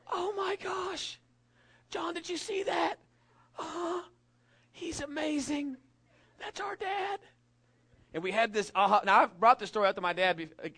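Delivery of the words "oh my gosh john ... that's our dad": put-on high, slow child voice full of wonder; awed little kid. Boy to his brother after dad's shot, high awed kid voice. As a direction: gush up high like an amazed six-year-old. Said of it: "And I went".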